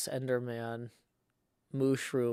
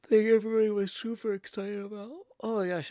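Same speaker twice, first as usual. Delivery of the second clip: hyper and loud, jittery; buzzing on coffee, high and loud; too much caffeine; 7 semitones higher, 7 dB louder than usual. Real, not acted. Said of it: "I've had three cups of coffee".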